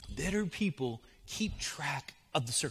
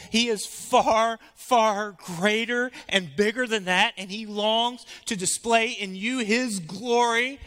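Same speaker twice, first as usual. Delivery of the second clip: louder, higher, slow preaching; fervent pulpit climax; preaching at full force; 7 semitones higher, 8 dB louder than usual. Acted. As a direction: build it up and proclaim.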